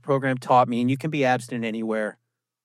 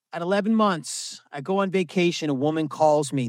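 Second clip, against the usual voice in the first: normal talking voice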